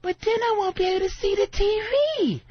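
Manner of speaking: hammed-up complaining girlfriend; whiny girlfriend. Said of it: "And then she goes".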